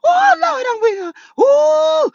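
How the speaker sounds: high, loud cry of delight; laughing cry of delight; shrieking oh lord. Real, not acted. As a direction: shriek it out, overcome with glee. Overcome with laughter.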